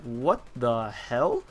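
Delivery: very slow, drawn-out bewilderment; stunned disbelief. Retold or imitated. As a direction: stretch each word in baffled shock. Stunned reaction to seeing Ronald.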